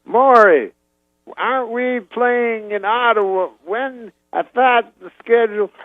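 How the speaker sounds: high, slow Cosby to his manager; Cosby asking his manager, impression. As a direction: call out high and muse slowly. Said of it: "he goes".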